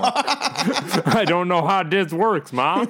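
high, slow dimwitted yokel through laughter; hammed-up backwoods simpleton. Mocks inbred hicks who can't fix wires.

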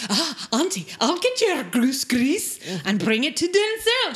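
high, loud mock-eager nephew; Ian. Eager nephew offers his aunt help, high mock eagerness. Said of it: "So Ian is like".

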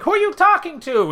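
high-pitched nagging wife voice; suspicious wife. Retold or imitated. Retelling the wife questioning her husband, suspicious.

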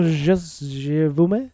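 slow, exaggerated French accent; fake French accent. Shown as a demonstration. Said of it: "a good fake French accent".

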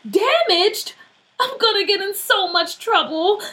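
high, distressed wail; about to cry. A narrator's reading. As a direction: cry out in dismay, near tears. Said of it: "about to cry".

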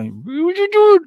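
very high, loud cooing at a dog; doggy baby talk. Acted. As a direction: squeal it to a beloved pup.

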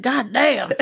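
mock a stunned country boy's twang; imitated country accent. Wife guessing husband's reaction to the birth, country boy accent. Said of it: "He'd probably just be like".

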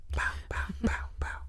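punchy whispered thumps; whispered sound effect. Retold or imitated. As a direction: whisper it in short percussive bursts. Mimes the sound of sex.

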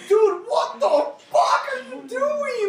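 high, slow disbelieving cry; incredulous dude. Retold or imitated. Friends reacting to her bawling, high disbelieving cry. Friends scolding crying Amanda.

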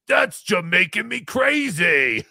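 slow shouted pun, hammed up; corny joke shout. Substitute teacher recalling his kids' favourite joke, shouted slowly. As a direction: belt the groaner pun slowly. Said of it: "The kids used to love that joke".

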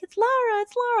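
put-on very high, repeated kid chirp; imitated excited kids. Kids who love each other greeting Lara, imitated excitement. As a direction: chirp it like thrilled children.

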